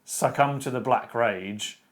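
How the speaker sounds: usual speaking voice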